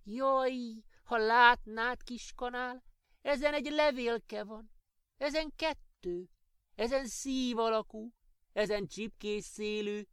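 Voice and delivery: high, eager little elf voice, marveling; a tiny elf gushing over painted eggs; high elf voice full of wonder. A narrator's reading. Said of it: "áradozott kis cimborájának".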